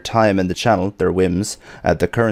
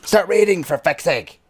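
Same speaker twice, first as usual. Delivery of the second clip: mock exasperated; 8 semitones higher than usual. Scolding chat for not raiding.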